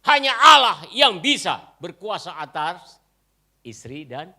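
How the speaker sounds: loud, hammering pulpit proclamation; full-force preaching. Acted. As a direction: drive each line home to the congregation. (khotbah lantang penuh semangat)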